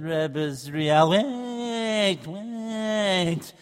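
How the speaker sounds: put-on soft, slow, drowsy old man; sleepy old rabbi. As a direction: mumble as if just woken.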